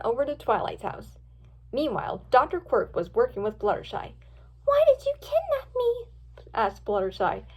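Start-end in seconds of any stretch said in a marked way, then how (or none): 4.6-6.1 put-on very high girl voice; high-pitched, distressed question; frightened captive. A narrator's reading. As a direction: question your kidnapper in a small, high voice. Captive girl questions her kidnapper.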